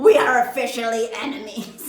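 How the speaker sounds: loud, laughing as it's repeated; laughing re-read. Real, not acted. Repeating her line to viewers, loud and laughing. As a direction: say it again, cracking up. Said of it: "who talks like that".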